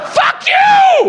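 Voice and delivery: very high, furious yell; enraged scream. Acted. Yelling at the movie's hero, enraged scream. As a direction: explode at him at full pitch.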